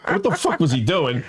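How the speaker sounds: normal talking voice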